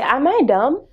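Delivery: ordinary delivery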